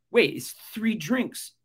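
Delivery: slow, high disbelief at the price; wide-eyed mock disbelief at cheap drinks; incredulous. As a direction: stretch it out like you can't believe it.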